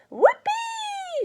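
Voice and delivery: shrill cackling crone whoop; high, loud witchy grandma whoop; gleeful shout. A narrator's reading. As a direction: screech with wild joy like a witch. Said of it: "she shouted".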